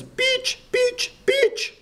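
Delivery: very high, yelling the name out the window; piercing high mock yell of a name. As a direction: squeal it like a kid hanging out of a bus. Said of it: "leans out and yells".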